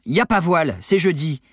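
high, loud comeback; indignant retort. A narrator's reading. Crewmate rebutting monitor's threat, indignant. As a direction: correct the monitor cheekily. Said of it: "répliqua Armand".

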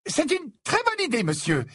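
high-pitched eager flattery; butter up the teacher, pitched high; fawning. Acted. Ernie flatters Professor Slughorn, fawning high voice. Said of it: "d'un ton flagorneur".

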